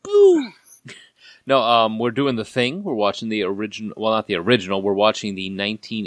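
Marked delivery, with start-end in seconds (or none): 0.0-0.5 sudden high, loud mock-scary boo; playful scare shout. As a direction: burst out as if playfully spooking someone. Mock scare after spooky pun.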